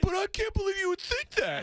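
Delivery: a high, silly voice of a guy denying everything; mock indignant Kreischer. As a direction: squeal out the innocent denial.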